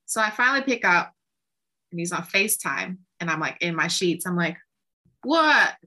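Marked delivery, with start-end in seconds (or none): 5.2-5.8 sharp, loud, high startled what; groggy surprise into the phone. Retold or imitated. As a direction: jolt awake and bark it out. Woken, answering the call.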